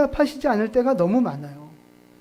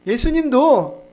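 normal talking voice

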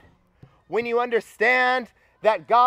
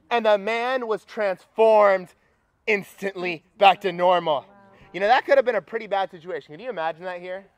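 normal talking voice

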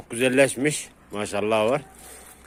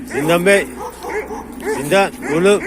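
raised, higher call to a dog; 6 semitones higher than usual. Real, not acted. Owner calling his dog Zindan, calling out.

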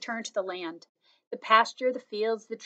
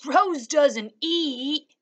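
put-on slow, higher matter-of-fact boy; drawn-out kid correcting his sister; 6 semitones higher, 6 dB louder, much slower than usual. A narrator's reading. Brother Jad replies.

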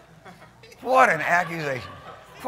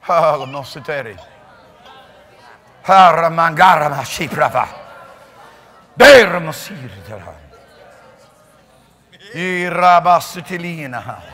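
speaking in tongues; 2 semitones lower, 10 dB louder than usual. Real, not acted. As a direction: pour out the syllables in prayer.

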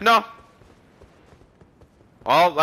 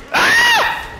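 very high panicked shriek; sudden high-pitched yell of shock; startled scream. Real, not acted. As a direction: shriek in fright at the monsters. Monsters screaming at him.

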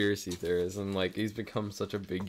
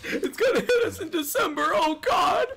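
very high, louder hysterical wail; mock panic; 4 dB louder than usual. Conspiracy fan panicking about the invasion, high hysterical wail. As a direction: shriek it like a panicked believer. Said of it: "people who are like".

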